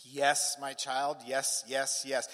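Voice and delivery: firm first yes, then even repeats; acted reassurance. Father assuring his child, steady reassurance. God's answer to the question.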